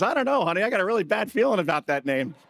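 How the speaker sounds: hinting at the wife's bad feeling; wife's wary voice. Wife's voice, high.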